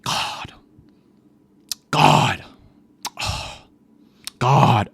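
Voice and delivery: mimicked classmate's heavy sighs of "God"; breathy huff, then a loud groan; exasperated groaning. Classmate groaning through the lesson, mock exasperation. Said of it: "under her breath, she's going".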